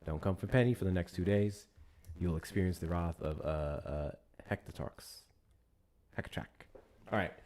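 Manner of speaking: ordinary delivery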